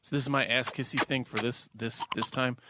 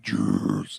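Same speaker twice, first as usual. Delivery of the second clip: very low growled name; deep vocal Juice. Retold or imitated. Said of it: "She did some vocal".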